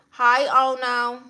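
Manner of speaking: ordinary talk, nothing marked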